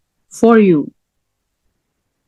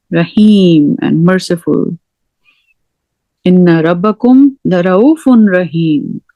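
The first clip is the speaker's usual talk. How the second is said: normal talking voice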